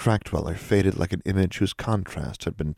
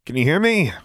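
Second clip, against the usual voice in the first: high, loud call; 11 semitones higher, 5 dB louder than usual. Acted. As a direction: shout up the cliff face. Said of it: "she called out".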